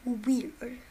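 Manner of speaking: talking through a yawn; mid-yawn words. Real, not acted. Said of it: "I was yawning".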